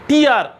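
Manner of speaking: टीआर said as "teer", short, with a falling tune; tear as in teardrop. Shown as a demonstration. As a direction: give the teardrop reading, teer. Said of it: "pronounce करेंगे टीआर". (टीआर, "टियर" जैसा उच्चारण)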